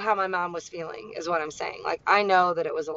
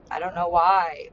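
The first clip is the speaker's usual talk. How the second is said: ordinary delivery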